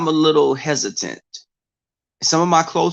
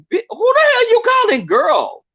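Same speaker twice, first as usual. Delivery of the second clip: very high, affronted mock snap; sassy indignant comeback; 4 dB louder than usual. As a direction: throw back the line with full diva attitude. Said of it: "and you be like".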